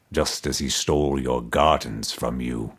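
resonant toneless skull. A narrator's reading. Buried emperor's skull answering Mordecai, low resonant toneless voice. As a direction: intone it without any tune, deep in the earth. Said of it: "answered in a resonant voice, toneless".

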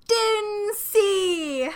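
high, drawn-out gleeful call; very loud. A narrator's reading. Child shouting her new word in a library, very loud. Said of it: "very loud".

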